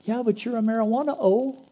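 high, loud teasing dude voice; teasing bro. Acted. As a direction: rib your buddy like a bro. Other buddy retorts.

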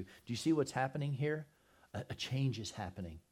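normal talking voice

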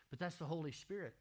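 ordinary delivery

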